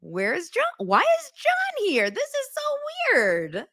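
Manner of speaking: very high, slow fake puzzlement; sarcastic high-pitched where's John; mock clueless sister. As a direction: overplay her innocent confusion. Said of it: "All of a sudden".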